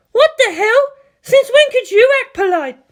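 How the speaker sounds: shrill furious screech, high and loud. A narrator's reading. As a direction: screech at her sister in outrage. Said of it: "she screeched out".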